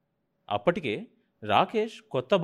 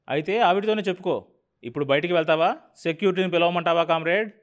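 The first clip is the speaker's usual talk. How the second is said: loud, harsh ultimatum; angry threat; 6 semitones higher, 6 dB louder than usual. Acted. New boss threatening the executive with security, loud and angry. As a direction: bark it out, daring him to stay.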